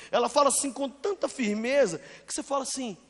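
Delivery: usual speaking voice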